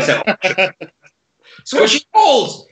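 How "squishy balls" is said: high, drawn-out mock exclamation; mocking catchphrase imitation. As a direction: parrot it back in a silly loud squeal. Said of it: "what you've said about every single thing".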